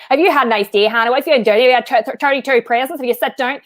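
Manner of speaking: chatty Irish relative on the phone; put-on Irish accent. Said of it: "like, father's parents and be like".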